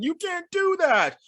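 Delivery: high, slow alarmed cry; shocked officers. Retold or imitated. Officer Jenny and the Russian lady objecting, high and slow. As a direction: gasp it out in disbelief. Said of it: "Officer Jenny and the Russian lady are like".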